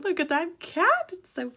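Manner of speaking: high-pitched delighted exclamation; excited high voice. Real, not acted. Vlogger spotting a cute cat in her book, excited. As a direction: voice leaps up in excitement. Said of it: "my voice goes up a couple octaves".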